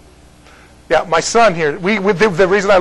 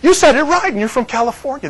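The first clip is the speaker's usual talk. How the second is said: surprised; 7 semitones higher than usual. Retold or imitated. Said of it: "they go".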